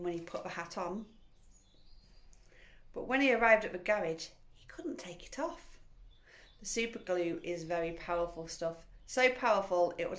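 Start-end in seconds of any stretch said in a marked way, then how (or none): 4.5-5.8 higher, hushed whisper for suspense; dramatic whisper. A narrator's reading. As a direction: whisper the twist. Story climax whispered.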